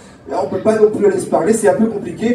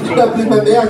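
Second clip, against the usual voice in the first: usual speaking voice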